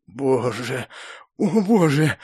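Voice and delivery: breathy, gasped thanks to heaven; breathing hard with relief. A narrator's reading. Rescued hero seeing he survived, breathing hard with relief. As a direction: pant it out as relief floods in. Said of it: "Тяжело задышал я от облегчения".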